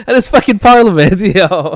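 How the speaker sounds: high and loud, laughing through the words; can't stop laughing at the story; laughing hard. Real, not acted.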